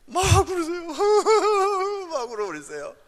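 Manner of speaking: high, loud put-on bawling; mock wailing. Shown as a demonstration. Preacher showing women how to cry at angry husbands, mock wailing. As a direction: show them a hammed-up wail. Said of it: "그리고 울으세요".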